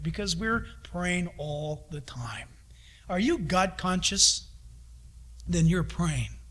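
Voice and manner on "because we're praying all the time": hushed whisper; soft unvoiced whisper. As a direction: drop to a whisper for emphasis. Whisper in sermon.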